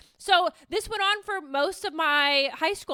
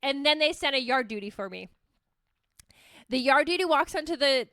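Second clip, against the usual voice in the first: usual speaking voice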